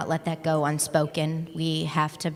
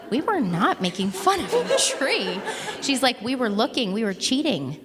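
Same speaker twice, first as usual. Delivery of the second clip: laughing protest; 7 semitones higher than usual. Retold or imitated.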